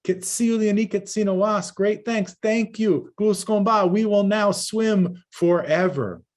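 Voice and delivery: high, loud joyful thanks; grateful people's cry. Acted. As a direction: call out thanks to the hero, lifted high. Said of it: "they said".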